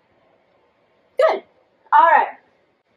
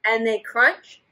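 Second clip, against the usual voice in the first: slow, expressive storybook reading; reading to children; 3 semitones lower, slower than usual. A narrator's reading. Teacher reading a story to children. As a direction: draw the kids into the story. Reads picture book aloud.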